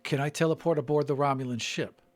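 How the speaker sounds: ordinary talk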